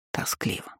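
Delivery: barely voiced, unvoiced whisper. A narrator's reading. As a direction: breathe the word out. Whispered longing.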